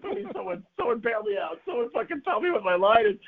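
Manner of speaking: high, mock-panicked begging; desperate plea. Begging classmates for the forgotten line.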